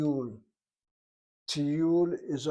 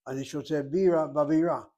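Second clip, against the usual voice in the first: "bira" front-stressed for beer, end-stressed for capital; contrasting syllable stress; 3 dB louder than usual. Shown as a demonstration. Teacher repeating the example for students, "bira" stressed first, then last syllable. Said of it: "where the accent on the first syllable is beer".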